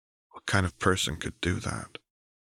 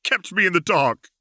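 high furious shout; angry shout; 5 dB louder than usual. Acted. Caitlin shouting at Jace to explain. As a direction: demand it, yelling. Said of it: "Caitlin snapped".

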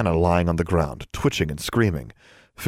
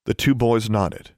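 ordinary delivery

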